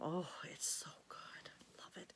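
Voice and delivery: soft whisper over the coffee; hushed happy whisper; whispered delight. Real, not acted. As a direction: breathe it out, savoring.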